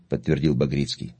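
plain reading voice

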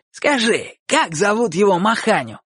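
high, pressing demand; revived, eager. A narrator's reading. Bocho revived, presses Chik for the name eagerly. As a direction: pounce on the question with fresh life. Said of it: "снова оживившись, прохрипел Бочо".

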